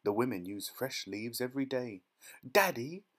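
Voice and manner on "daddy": high, loud bratty call; demanding shout. A narrator's reading. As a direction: spoilt girl shouting for her father. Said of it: "shouted Veruca Salt".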